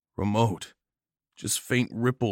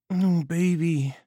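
tender; 9 semitones higher, slower than usual. Acted. Nagel comforting the injured narrator.

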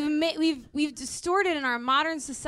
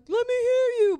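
hype-man call to the crowd; like a concert hype man; 11 semitones higher, 3 dB louder than usual. Retold or imitated. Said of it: "that kind of person that's like".

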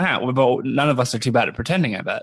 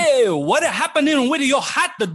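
high, drawn-out Italian waiter; Italian accent; 10 semitones higher, slower than usual. Acted. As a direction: greet the customer in a hammed-up Italian accent. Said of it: "you can do an accent if you want to".

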